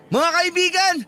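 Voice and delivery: shouting announcement. Acted. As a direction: call out the news to everyone around. Announcing to the whole restaurant.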